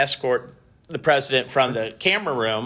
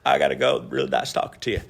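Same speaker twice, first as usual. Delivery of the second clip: Bill Clinton imitation. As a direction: drop your voice into a Clinton impression. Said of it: "I'll do my Clinton imitation".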